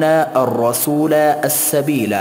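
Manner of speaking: ordinary delivery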